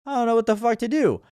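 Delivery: high, lost and sheepish; mock helpless shrug voice; clueless dude. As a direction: play the guy with no clue. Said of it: "just like".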